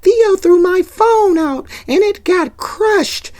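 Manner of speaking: high, slow whiny tattling; tattling little girl. Retold or imitated. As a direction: tell on him to mom, whining.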